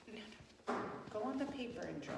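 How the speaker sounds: soft, fast hushed redirect; hushed teacher aside; quick aside. Real, not acted. Teacher redirects child mid-story.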